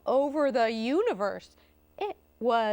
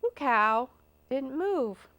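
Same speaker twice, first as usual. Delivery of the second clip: usual speaking voice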